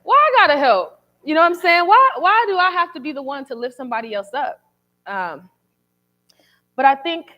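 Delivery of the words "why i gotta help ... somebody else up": high, loud retold protest; annoyed. Daughter pushing back at her dad, annoyed and high-pitched. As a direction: replay an exasperated complaint, pitched up. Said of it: "And I was annoyed".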